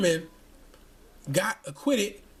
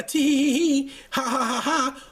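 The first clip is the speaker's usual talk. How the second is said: mock smug giggle; 4 semitones higher than usual. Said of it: "sitting here flaunting".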